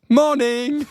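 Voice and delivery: high, drawn-out morning call; retold cheery shout. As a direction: holler it high like a wake-up call. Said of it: "and shouted".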